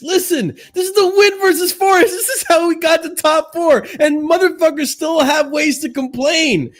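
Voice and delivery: very high, loud outraged shouting; high-pitched exasperated tirade; loud, clipping rant. Real, not acted. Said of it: "Let me lower myself 'cause I know I'm clipping a lot".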